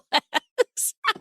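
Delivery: high, light laugh; real tickled laughter; delighted laugh. Real, not acted. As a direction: break into a high laugh after gushing praise. Delighted praise ends in laughing.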